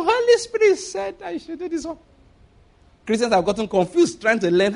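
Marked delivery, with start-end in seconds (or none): 0.0-2.0 high mock voice, sheepish; mock believer. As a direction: squeak it like a flustered believer.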